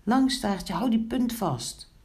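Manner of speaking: high, urgent instruction; calling out. A narrator's reading. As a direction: call a helper into action. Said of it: "zwom daarmee naar boven en riep". (hoog, dringend roepend)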